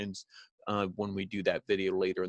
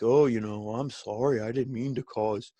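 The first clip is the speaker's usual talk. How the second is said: Rollins, mock apology. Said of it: "And then Rollins is like".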